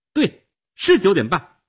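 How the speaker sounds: high, loud confirmation; angry insistence. Acted. Angry woman insists on the time.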